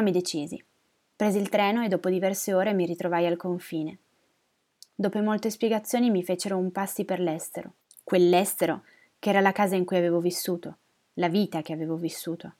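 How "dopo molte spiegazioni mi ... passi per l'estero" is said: ordinary delivery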